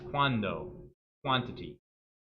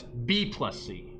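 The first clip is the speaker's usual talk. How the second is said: B plus C set apart after a pause; grouped by emphasis; 2 semitones lower, 3 dB quieter, much slower than usual. Shown as a demonstration. Math teacher showing order with emphasis, grouped stress. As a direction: stress "B plus C" as one unit. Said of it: "That little emphasis there on syllables".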